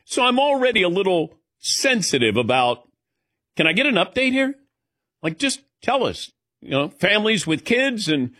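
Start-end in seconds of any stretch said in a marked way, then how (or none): 3.6-6.3 higher-pitched plea for an update; impatient passenger. Acted. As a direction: press for news like a fed-up passenger. Voicing an impatient waiting passenger.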